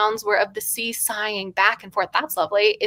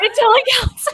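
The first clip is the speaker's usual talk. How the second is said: high laughing insistence; 5 dB louder, faster than usual. Real, not acted. Reader insisting bonbons count, laughing. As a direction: laugh it off triumphantly.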